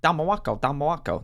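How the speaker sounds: high-pitched wrestler impression; lightly voiced Haiti Kid; imitating Haiti Kid. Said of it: "That was Haiti, wasn't it?".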